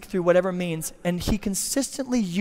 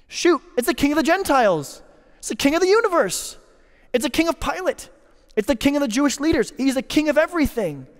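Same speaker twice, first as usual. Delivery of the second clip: rising excitement; 6 semitones higher, faster than usual. Real, not acted. Preacher building to exclamation.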